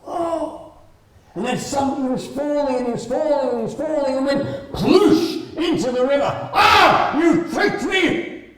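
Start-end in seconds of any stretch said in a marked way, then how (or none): 0.0-0.7 high, breathless gasp of wonder; awed whoa. Acted. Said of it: "and he's like". 6.5-8.5 high, loud outraged cry, slowing at the end; spluttering mouse yelling at Frog; indignant yell. Acted. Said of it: "he yelled at Frog".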